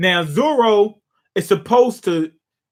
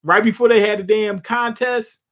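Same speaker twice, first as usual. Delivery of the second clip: ordinary talk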